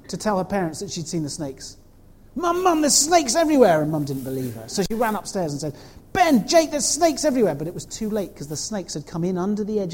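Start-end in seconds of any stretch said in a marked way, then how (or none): 2.4-3.8 high, loud alarmed call to Mum; frightened call. Acted. A girl warns her parents of snakes, calling out loud and high. 6.1-7.6 high, loud shout up the stairs; frightened call. Acted. A girl shouts up to her brothers about snakes, high and loud.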